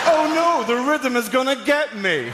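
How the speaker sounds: high hammed-up terror; hammed-up movie fright; mock panic. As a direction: gasp it like a fleeing hero.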